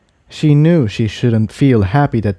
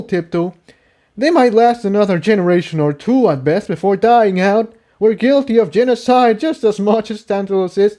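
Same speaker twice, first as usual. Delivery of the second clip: raised, bitter character voice; guilt-ridden argument in a higher voice; voiced stallion arguing; 11 semitones higher than usual. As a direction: stallion confessing guilt to Tiptoe.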